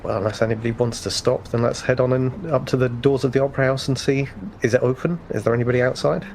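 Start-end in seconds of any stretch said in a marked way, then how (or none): none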